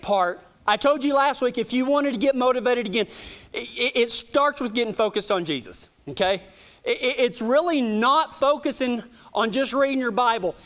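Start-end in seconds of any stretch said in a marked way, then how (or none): none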